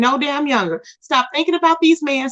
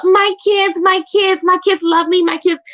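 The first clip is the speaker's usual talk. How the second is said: high mock whine of my kids; mock her, pitched up and whiny; 6 semitones higher than usual. Mocking Jazzy's whining.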